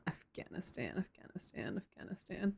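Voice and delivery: whispered repetition while jotting it down; barely voiced note to self; muttering to oneself. Real, not acted. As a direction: say it under your breath, very softly. Repeats it to herself while adding.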